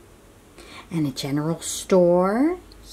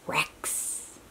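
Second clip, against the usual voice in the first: plain reading voice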